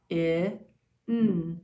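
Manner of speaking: short i, pause, held n; two separate sounds, I then N; sounding out. Shown as a demonstration. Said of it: "Two sounds".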